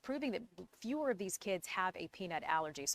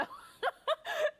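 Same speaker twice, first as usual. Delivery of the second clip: high laugh; 3 dB louder than usual. Real, not acted. Anchor laughs at the name.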